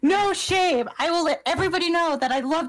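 high, playful chant; excited. Real, not acted. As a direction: echo it gleefully. Echoing chant.

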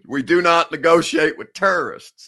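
hammed-up Southern accent impression; Bush Southern accent. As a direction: play it like a Texan president at the podium. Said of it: "making fun of people with a Southern accent".

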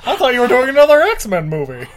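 high, loud incredulous question; mock surprise. Fan questions Fassbender about his movie, mock surprised. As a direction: exclaim it in pretend bafflement.

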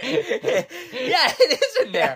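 high, laughing admission. Real, not acted. Telling friend her car is in the lot, high and laughing. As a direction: squeeze the words out through giggles.

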